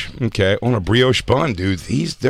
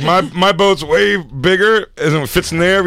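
mock boat owner; 8 semitones higher, slower than usual. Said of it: "he's like".

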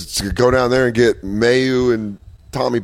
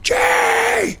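very high shouted name; out-of-control fan. Acted. Overzealous fan calling to the player, high shout. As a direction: shriek the name from the stands. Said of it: "like, a out of control fan".

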